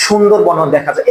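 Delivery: ordinary talk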